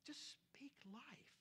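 soft, slow whisper; whispered sermon emphasis; hushed whisper. Real, not acted. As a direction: lean in and breathe it to them. Preacher drops to a hush.

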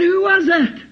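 high, startled demand; acted stunned outburst; shocked question. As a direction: blurt it out in sudden alarm. Boy's sudden shock, acted.

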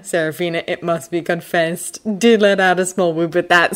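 ordinary delivery, nothing marked

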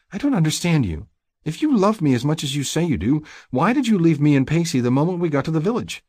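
high-pitched woman's voice, hurt; high, accusing bewilderment; upset, confused mate. Acted.

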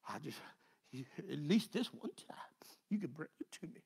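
hushed, breathless asking; whispered plea. Acted. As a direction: whisper it like a secret prayer. Begging God in a hush.

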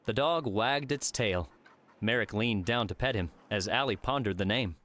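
usual speaking voice

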